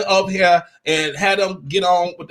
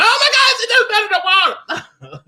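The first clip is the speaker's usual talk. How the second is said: high, loud imitated shriek of panic; a mock scream, pitched way up and loud; imitating screaming girls; 4 dB louder than usual. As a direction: mimic girls shrieking as she falls in. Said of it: "you hear some girls screaming".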